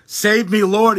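loud, high panicked cry; shout for help. Acted. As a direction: cry out while sinking. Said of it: "he shouted".

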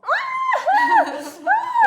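high loud shrieking laugh. Real, not acted. Sour sting makes a cry.

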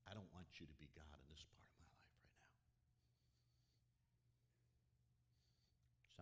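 fast, hushed confession; a hidden prayer whispered to God; secret whisper. Retold or imitated. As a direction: whisper it quickly as a private admission.

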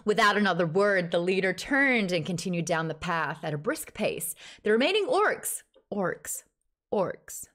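American-accented audiobook reading. Shown as a demonstration. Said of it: "Read it in an American accent".

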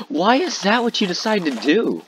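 very high-pitched, incredulous whine; indignant squeal without extra volume; exasperated disbelief. Real, not acted. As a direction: demand an explanation, voice way up. Still outraged at the cheater.

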